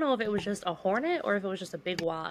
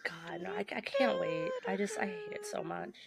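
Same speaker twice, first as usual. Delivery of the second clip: shuddering revulsion; 11 semitones higher, 6 dB quieter than usual. Real, not acted. High disgusted squeal about bugs.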